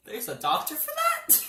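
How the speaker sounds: high-pitched mock disbelief; incredulous question. Son and mom hear of the specialist, high incredulous question. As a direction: squeak it upward, baffled.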